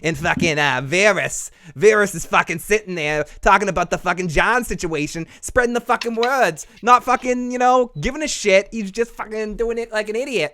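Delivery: playful Boston tough talk, rising in pitch; Boston accent. Pretend. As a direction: rant like a mock Bostonian. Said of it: "I sounded Boston there for a second".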